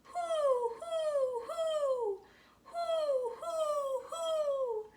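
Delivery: high hooting owl call; long, high, hollow owl hoots. Acted. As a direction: hoot like a great horned owl. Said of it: "And then Pa called".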